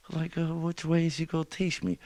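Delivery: very high questioning rabbit voice; doubtful rabbit. Acted. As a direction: hold it high all through, as a wondering rabbit. Rabbit wary of cat's offer.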